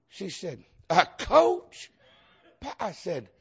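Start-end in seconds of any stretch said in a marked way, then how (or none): 0.9-1.9 high incredulous "A Coach?"; a clerk who can't believe the request, pitched up; mock surprised clerk, high.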